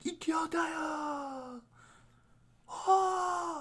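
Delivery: high, slow, stretched rallying shout; rally the crowd with a long high yell; hype shout. Acted. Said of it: "be like".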